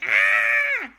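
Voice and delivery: very high, loud terrified scream; Balthazar Brat. Acted. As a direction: shriek in cartoon panic. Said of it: "saw the velociraptor coming from him and went".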